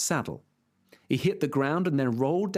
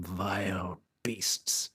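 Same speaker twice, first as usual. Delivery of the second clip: whispering; 6 semitones lower, 5 dB quieter, much slower than usual. Acted. Knight sees giants.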